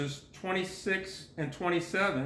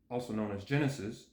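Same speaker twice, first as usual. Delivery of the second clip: low, quick, softer aside; parenthetical aside; 7 semitones lower, 5 dB quieter, faster than usual. Real, not acted. Reader glossing a book title, lowered aside. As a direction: drop the pitch and volume, slip it in quickly.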